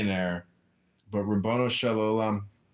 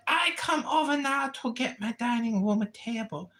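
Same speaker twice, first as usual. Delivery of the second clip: high, whiny immigrant tenant voice; mock tenant voice; 11 semitones higher than usual. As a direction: whine like a fretful tenant. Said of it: "She's like".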